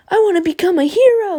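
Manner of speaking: very high, loud squeal; high mock hero cry. Mocking a hero's ambition, high-pitched yell. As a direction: belt it out in a silly high voice. Joking opposite of Deku's deep voice.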